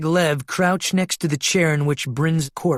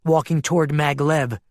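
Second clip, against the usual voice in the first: plain reading voice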